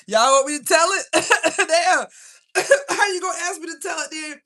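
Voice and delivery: high and loud, laughing through the words. Real, not acted. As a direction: laugh it off, caught out by the chat. Caught out mid-reading, laughing.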